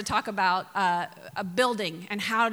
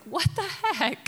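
husband's startled, drawn-out high call; a high, slow mock of his alarm; 7 semitones higher, slower than usual. Husband running down the hall, startled and high. Husband rushes in hearing screams.

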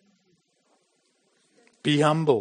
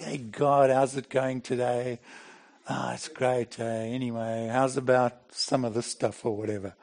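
mock casual chat; 3 semitones lower, 6 dB quieter than usual. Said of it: "I'm never like".

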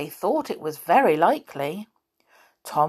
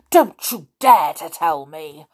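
fierce; 8 dB louder than usual. Acted. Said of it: "said the bear fiercely".

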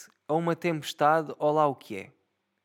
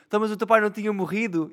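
gleeful gotcha; 7 semitones higher, 5 dB louder, faster than usual. Retold or imitated. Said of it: "E depois eu disse-lhe assim".

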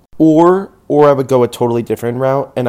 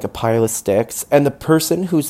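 ordinary delivery

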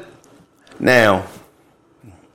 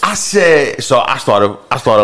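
normal talking voice

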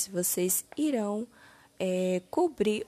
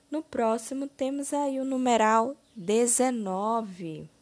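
ordinary talk, nothing marked

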